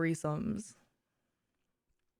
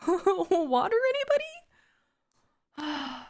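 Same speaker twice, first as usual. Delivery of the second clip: much higher, chirpy, awkward offer; awkward hostess; 11 semitones higher than usual. Pretend. Left out of the threesome, offering drinks, awkwardly chirpy. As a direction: cover the awkwardness with fake cheer. Third wheel offering water.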